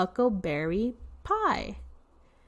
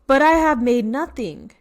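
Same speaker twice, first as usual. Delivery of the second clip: loud bear voice; 4 semitones higher, 7 dB louder than usual. Acted. Bear voiced reading storybook.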